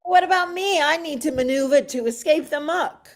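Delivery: ordinary delivery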